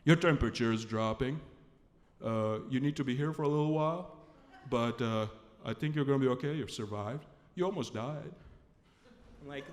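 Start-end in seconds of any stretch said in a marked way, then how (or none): 0.0-8.3 low, steady doctor voice; imitated deep-voiced doctor giving a verdict; measured, low delivery of the news. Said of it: "Takes the temperature again and says".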